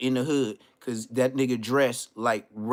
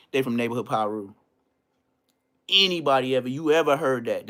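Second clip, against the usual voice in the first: ordinary talk, nothing marked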